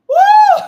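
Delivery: high shouted woo; ecstatic whoop. Real, not acted. Preacher whooping to the congregation, loud high woo. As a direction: let out a joyful preacher's whoop.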